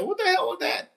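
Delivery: teacher startled by a shout, high shock. Retold or imitated. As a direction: react to a sudden insult. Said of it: "I'm looking around like".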